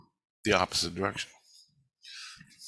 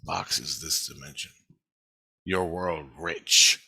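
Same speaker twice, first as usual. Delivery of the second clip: hint of a hushed divine voice, lower; God speaking, just a touch lower and hushed; lightly voiced God; 5 semitones lower than usual. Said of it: "it was like God going".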